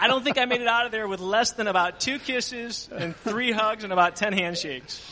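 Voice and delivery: chuckling while telling it; the speaker laughing at being kissed and hugged. Real, not acted.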